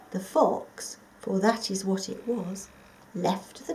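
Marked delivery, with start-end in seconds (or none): none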